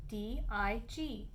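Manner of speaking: ordinary delivery, nothing marked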